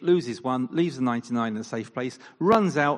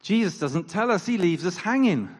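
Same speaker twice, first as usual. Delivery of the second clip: ordinary delivery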